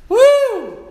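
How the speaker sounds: a high, loud joyful whoop of praise; sudden high-pitched cry of worship; ecstatic whoop. Real, not acted. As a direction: let out a jubilant high woo. Preacher's ecstatic outburst.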